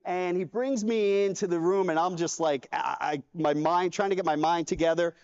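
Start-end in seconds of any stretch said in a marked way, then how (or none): none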